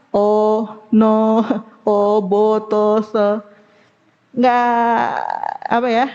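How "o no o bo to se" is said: "O, no, o, bo, to" with every vowel heavy and rounded; thickened letters. Shown as a demonstration. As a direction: make each letter thick, "bo, to". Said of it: "Huruf-hurufnya jadi tebal-tebal semua". ("o, no, bo, to" serba tebal membulat)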